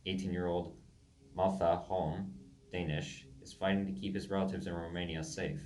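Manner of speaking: ordinary talk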